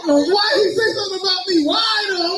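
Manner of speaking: indignant shout. Real, not acted. Comic mock indignation.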